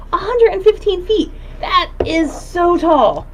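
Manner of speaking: high laughing amazement; amazed. Real, not acted. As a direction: marvel at how tall it is. Amazed at tree height, laughing.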